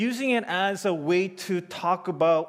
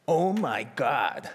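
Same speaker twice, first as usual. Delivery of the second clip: drawn-out oh my God; 2 semitones lower, slower than usual. Shown as a demonstration. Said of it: "When I say O M G".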